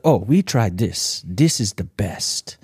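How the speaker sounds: slow, lightly put-on Haitian accent of the psychiatrist. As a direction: imitate the Haitian psychiatrist's accent, drawing the words out. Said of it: "'cause of her accent".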